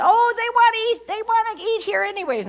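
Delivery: put-on high, sing-song teenage girl voice; imitating her teenage daughter. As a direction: chirp it like a breezy teen. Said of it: "She said".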